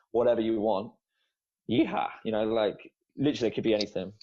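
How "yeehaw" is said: a quick, much higher joking yeehaw; playful cowboy call mocking unqualified coaches; mock cowboy whoop. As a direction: toss off a jokey cowboy holler. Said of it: "lots of cowboys".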